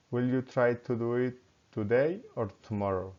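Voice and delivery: first choice up, second choice down; rising then falling. Shown as a demonstration. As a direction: climb on "five", "morning", "today", then fall on the alternative. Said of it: "rising and falling intonation".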